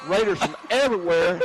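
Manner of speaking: thrilled broadcaster call; excited. Real, not acted. Tackle on kickoff return.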